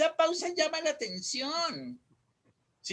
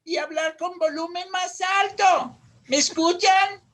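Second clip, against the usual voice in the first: rising to higher, louder projection; raised volume; 4 semitones higher, 5 dB louder than usual. Shown as a demonstration. Teacher demonstrating emphasis to the class, raised voice. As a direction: lift pitch and volume for emphasis. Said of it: "subir un poquito el tono de la voz".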